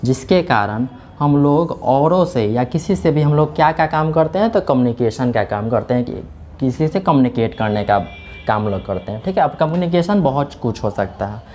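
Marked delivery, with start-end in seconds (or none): none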